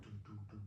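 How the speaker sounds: low, soft drum thuds; imitated drum beats. Man showing how he played the buffalo drum, soft imitated beats.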